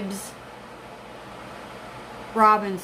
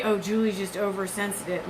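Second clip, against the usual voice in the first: dismissive father; 4 semitones lower, 6 dB quieter than usual. Retold or imitated. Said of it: "he would tell everybody".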